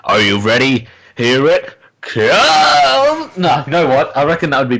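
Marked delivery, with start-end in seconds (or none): 1.2-3.3 long shout. Retold or imitated. Drawn-out villain line.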